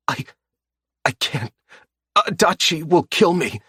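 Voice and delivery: a hint of terror, stuttering; bloodied captive refusing out of fear, voice high; frightened captive. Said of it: "he mutters".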